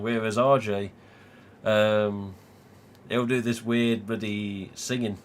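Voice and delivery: normal talking voice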